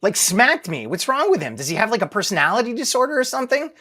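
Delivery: imitating a baffled child, high-pitched; confused kid. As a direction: voice a little kid's puzzled protest. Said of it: "He was probably just confused".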